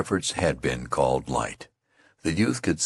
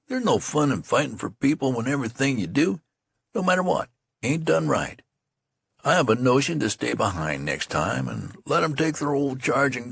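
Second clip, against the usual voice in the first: put-on wounded young soldier; 7 semitones higher than usual. Friend complains about bad luck: higher hurt grumbling soldier. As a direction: sulk over the unfairness.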